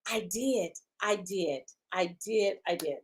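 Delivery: sheepish quiet admission. Real, not acted. Host admitting the mistake to viewers, softly and sheepishly. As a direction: own it sheepishly, almost under breath. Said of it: "quiet admission".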